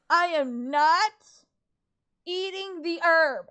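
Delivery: slow, loud, stunned protest; gasp, then refuse in disbelief; shocked outburst. Real, not acted. Gamer protesting at the screen, loud and stunned.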